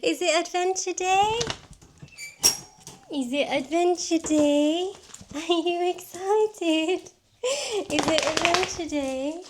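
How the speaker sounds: high, soft sing-song voice for the dog. Real, not acted. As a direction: coax the puppy with a high cooing voice. Baby talk to the dog.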